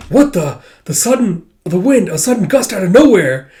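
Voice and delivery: startled outburst; shocked. Retold or imitated. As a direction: sound thrown by a surprise. Shocked by gust again.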